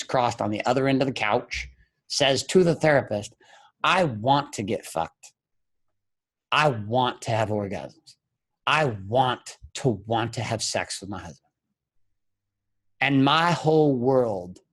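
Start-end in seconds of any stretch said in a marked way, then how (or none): 3.8-11.4 slow, weighted words; deliberate confession. Retold or imitated. Wife tells therapist what she wants, slow and deliberate. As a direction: spell out each want slowly and deliberately.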